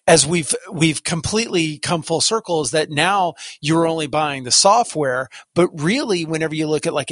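ordinary delivery

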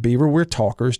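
usual speaking voice

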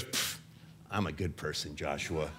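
low, quiet dismissive scoff; a son imitating his dad's grumbling dismissal; gruff dad brushing it off. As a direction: play the stubborn old father waving it away. Dad's dismissive reply retold.

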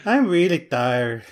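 slow, sleepy cartoon Mexican accent. Teasing co-host keeps the gag going, slow cartoon Mexican accent. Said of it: "that Slowpoke Rodriguez one".

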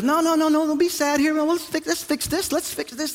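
mock panicky problem-solving; anxious fixing. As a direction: act out a husband heading off sadness. Acts out rushing to fix her sadness.

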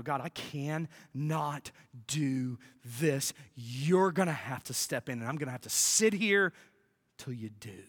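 low, slow, hushed plea to God; quiet surrender. Acted. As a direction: pray it softly, giving up and waiting. Acted weary surrender in prayer.

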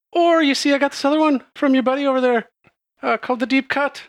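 high, quick showy female voice; Kalora speaking. Acted. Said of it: "slides it onto the table".

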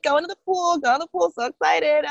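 put-on high singsong kid voice; lightly voiced excited niece. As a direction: chant it like an eager little kid. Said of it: "my niece is like".

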